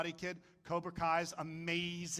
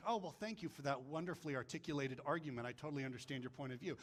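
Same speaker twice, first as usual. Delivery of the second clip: lower, quick sarcastic agreement; mock-polite wife; 4 semitones lower, 6 dB quieter, faster than usual. Wife answering a yelling husband, mock-sincere. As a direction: rattle it off drily, getting quieter. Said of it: "and she's like".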